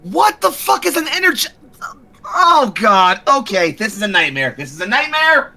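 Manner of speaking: high, panicked outburst. Acted. Woman panicking at the alien reveal, high and shrill. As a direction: spiral into panic.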